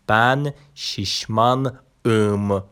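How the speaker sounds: بن شیشمان ام said slowly and carefully; slow Turkish example. Shown as a demonstration. Teacher to students, models a Turkish sentence slowly. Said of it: "نگاه کن".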